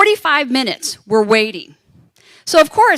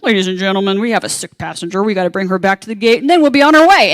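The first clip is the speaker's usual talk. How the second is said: low captain announcement, lifting loud at the end; Pilot John; 3 semitones lower, 4 dB louder than usual. Retold or imitated. Pilot tells passengers of sick passenger, intercom announcement. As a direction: announce evenly, then swell brightly. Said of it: "Pilot John goes on".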